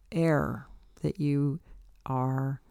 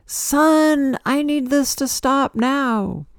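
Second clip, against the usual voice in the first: much higher, louder, slow demanding cry; poetic yell at the sun; 11 semitones higher, 7 dB louder, slower than usual. Retold or imitated. Addressing the sun on sun day, a loud poetic demand. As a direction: call out to the sun, pleading it stop. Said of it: "poetically like".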